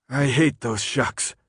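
slow, drowsy last words; slow sleepy mumble going unconscious; fading out. Acted. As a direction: say it as you slip under. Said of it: "When the darkness started to take him".

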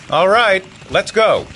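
loud, high-pitched call to set off; rally the group at full voice. Acted. Leader to boys lining up, calling them onward. Leader calls troop to move.

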